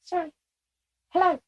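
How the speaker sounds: high squeaky hello; squeaky channeled voice. Acted. Channeled young lieutenant greets the sitters in a squeaky voice. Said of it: "somebody that has a very squeaky voice".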